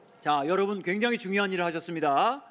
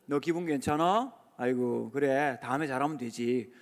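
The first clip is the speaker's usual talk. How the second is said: gentle reassurance; 6 semitones lower than usual. Shown as a demonstration. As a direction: ease the child's worry gently. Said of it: "이렇게 물어보시면 안 되고".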